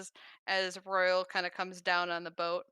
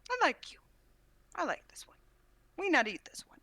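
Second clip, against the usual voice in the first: odd simple voice; 9 semitones higher than usual. Acted. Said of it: "He goes".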